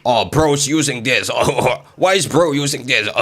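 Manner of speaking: put-on voice of a complaining viewer; hammed-up groaning imitation of a chat critic; whiny, exaggerated mock dismay; mocking commenters. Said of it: "But if I see one more".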